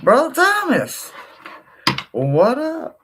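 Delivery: chirpy greeting. Real, not acted. To Brother Thomas in chat, a high chirpy greeting. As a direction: sing out a hello.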